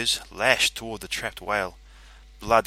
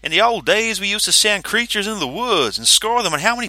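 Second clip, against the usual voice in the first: furious complaint; 11 semitones higher, 7 dB louder than usual. A narrator's reading. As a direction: blame him to his face. Kitamariki ranting.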